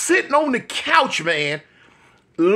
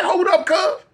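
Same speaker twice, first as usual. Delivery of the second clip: urgent hold up; 9 semitones higher than usual. Retold or imitated. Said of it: "I said".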